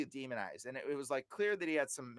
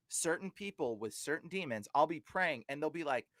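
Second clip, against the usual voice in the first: ordinary talk, nothing marked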